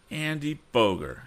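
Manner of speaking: high surprised cry of the name. A narrator's reading. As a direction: recognize him with a jolt. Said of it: "he cried".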